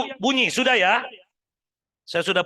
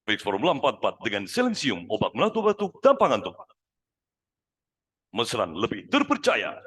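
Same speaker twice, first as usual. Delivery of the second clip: ad voiceover; 9 semitones lower than usual. Pretend. Ex-hypnotist recites familiar ad slogans in an announcer voice. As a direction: deliver it like a TV ad. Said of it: "Pernah dengar?".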